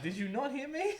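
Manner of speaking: squeaky high mock indignation; mock high indignant. Friend voicing a character's outrage, squeaky high. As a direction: pitch it way up in fake outrage. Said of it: "It's like".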